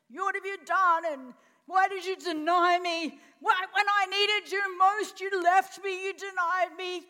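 loud, high, outraged confrontation; angry reproach. Acted. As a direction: hurl the betrayal back at them. Imagined angry Jesus confronting Peter.

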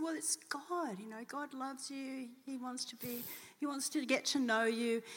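gently telling her she is loved; tender reassurance. Retold or imitated. Telling grieving woman God loves her.

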